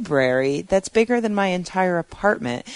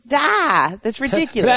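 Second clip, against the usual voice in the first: exasperated outburst; 7 semitones higher than usual. Real, not acted.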